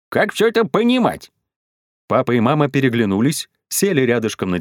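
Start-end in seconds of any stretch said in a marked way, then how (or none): 0.1-1.3 high, stern demand. A narrator's reading. Grandmother questions the parents about the chaos, stern. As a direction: demand an answer severely, pitched up. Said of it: "Потом спросила строго".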